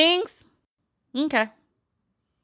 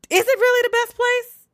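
much higher, mock-incredulous; sarcastic disbelief; 14 semitones higher than usual. Real, not acted. Answering Terry's gushing, high sarcastic disbelief. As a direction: scoff at the claim.

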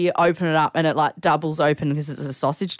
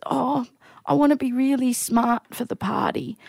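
choked up; 7 semitones higher than usual. Real, not acted. Storyteller voicing elderly man's request, choked up. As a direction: push through the quote near tears. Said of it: "I actually can't even talk about it".

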